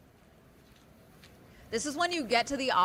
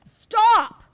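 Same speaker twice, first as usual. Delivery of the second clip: sudden loud high yell of Stop!; shouting stop; 11 semitones higher, 12 dB louder than usual. Real, not acted. Streamer yelling at the intruder on screen, loud alarm.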